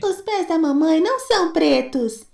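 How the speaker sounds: put-on high child voices rising; tiny goats unmasking the wolf; kid goats. Kids accusing the wolf, high.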